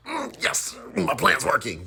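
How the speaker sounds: high, gleeful inner voice; secret scheming glee. Retold or imitated. Daughter thinks her plan's working, mock-sly delight. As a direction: gloat privately like a mock schemer. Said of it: "And in my head".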